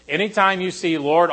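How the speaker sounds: ordinary delivery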